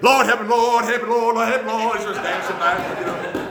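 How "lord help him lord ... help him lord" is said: high, loud hammed-up pleading prayer; mock fervent prayer. Song leader praying loudly over the man who won't stand, mock fervent. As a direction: cry out to the Lord over him, hammed-up. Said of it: "He's like".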